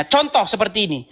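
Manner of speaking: ordinary talk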